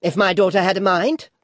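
put-on high, loud old lady; challenging old lady. A narrator's reading. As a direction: press the point indignantly. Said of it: "said the old lady".

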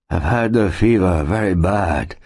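sick settler's character voice; faint answer. A narrator's reading. Ailing settler tells Mark of his fever, faintly. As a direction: answer as one weakened by fever. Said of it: "he answered faintly".

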